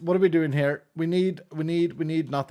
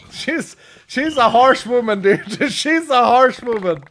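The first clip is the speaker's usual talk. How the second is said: laughing amused; 7 semitones higher, 6 dB louder, slower than usual. Real, not acted. Laughing about harsh mother.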